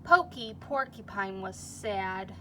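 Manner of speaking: lively storybook reading to a class; reading to children. A narrator's reading. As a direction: read it like story time for little kids. Reads picture book to class.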